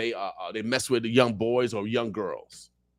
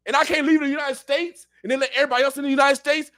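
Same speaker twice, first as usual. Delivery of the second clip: high, incredulous rhetorical questions; outraged disbelief; 12 semitones higher, faster than usual. Real, not acted. Asking listeners if he'd vote for Biden, high outraged disbelief. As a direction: voice shooting up in outrage.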